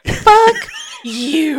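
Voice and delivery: high, loud burst of playful offence; loud, drawn-out mock-offended retort; laughing shout. Real, not acted. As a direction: holler it through laughter. Laughing reply to a teasing insult.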